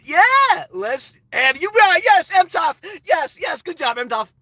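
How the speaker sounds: loud, high hype for a chat comment; excited cheering. Real, not acted. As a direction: cheer the viewer on, fired up.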